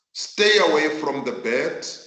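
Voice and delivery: normal talking voice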